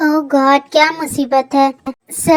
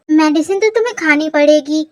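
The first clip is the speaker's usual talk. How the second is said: ordinary delivery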